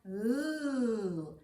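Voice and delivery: "L" as a held ringing L; held L sound. Shown as a demonstration. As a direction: sustain "L" with the tongue up. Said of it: "pull a lemon off the tree and go".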